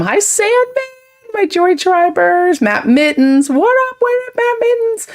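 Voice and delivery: very high-pitched, squealing hello; excited greeting. Real, not acted. Streamer greeting favourite chat regulars, high-pitched excitement.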